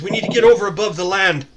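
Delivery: loud, fast, urgent command; shouted order. Acted. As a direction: call it out over the commotion. Captain shouting orders during emergency.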